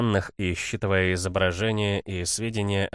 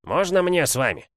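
high, raised eagle voice; eager eagle; 10 semitones higher, 4 dB louder than usual. A narrator's reading. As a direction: plead to join, pitched way up. Eagle begging to come along. (высокий просящий голос орла)

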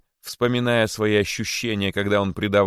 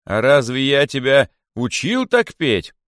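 high, loud, slow rhetorical question; stern reproach; 5 semitones higher, 6 dB louder than usual. A narrator's reading. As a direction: rebuke him as an offended king. King scolds the accused rabbit. (грозный королевский упрёк)

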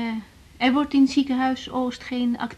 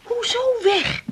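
shocked disbelief; 12 semitones higher, slower than usual. Acted. Shocked at father leaving.

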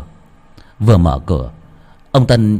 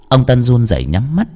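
usual speaking voice

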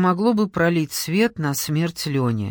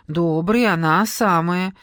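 ordinary delivery, nothing marked

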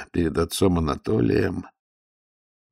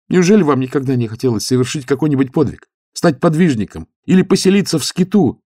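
young idealist voiced higher and louder; high, loud zealous youth; eager young monk; 7 semitones higher, 6 dB louder than usual. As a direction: lift the voice, earnest and keen. Youth questions stoker.

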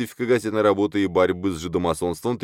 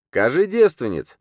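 high, loud blunt order; stern demand; 8 semitones higher, 5 dB louder than usual. A narrator's reading. Blunt peasant demanding women of the merchant, stern. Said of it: "сурово сказал прямой мужик Бермята".

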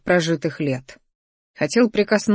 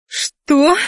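high, loud tearful squeal; put-on girlish screech; shocked shriek; 9 semitones higher, 5 dB louder than usual. A narrator's reading. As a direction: shriek it in outrage. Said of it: "взвизгнула зарёванная адептка".

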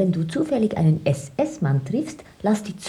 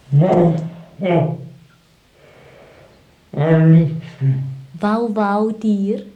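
loud dog bark; 3 semitones higher, 8 dB louder, much slower than usual. Acted. Pretending to be a dog as he leaves, a loud mock bark.

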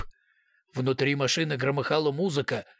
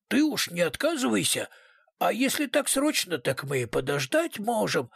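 surprised; 6 semitones higher than usual. A narrator's reading. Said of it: "удивлённо произнёс".

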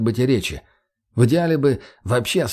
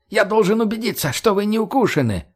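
high, squealed insistence; squealing; 10 semitones higher than usual. Acted. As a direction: keep squealing the demand. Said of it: "перешёл на визг мужик". (визгливо)

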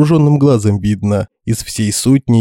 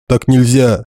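shouted; 3 semitones higher than usual. A narrator's reading. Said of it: "выкрикнул Генрих Медведь".

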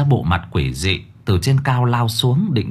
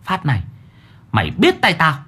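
through gritted teeth; 6 semitones higher than usual. A narrator's reading. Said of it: "Nó nhìn con quỷ nghiến răng".

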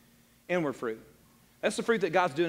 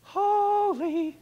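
mock holy voice; 13 semitones higher than usual. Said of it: "and go".

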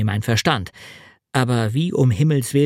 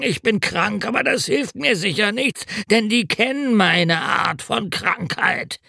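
hungover road mender; 9 semitones higher than usual. A narrator's reading. Road mender telling narrator of the wedding binge, high drunken lament.

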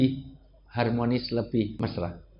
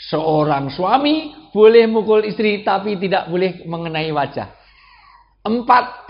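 raised, emphatic listing of rules; full-force preaching; 6 semitones higher, 7 dB louder than usual. Real, not acted. Preacher lists limits on husbands, forceful preaching. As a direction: hammer out each numbered rule.

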